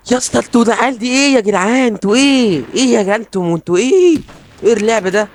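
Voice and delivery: high, incredulous protest; exasperated disbelief. Real, not acted. Exasperated gamer at enemy players. (استنكار بصوت عالي)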